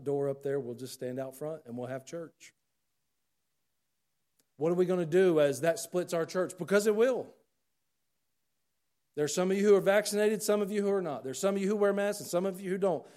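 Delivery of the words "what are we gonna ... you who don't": loud, high fiery preaching; preaching at full force. Acted. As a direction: thunder it from the pulpit.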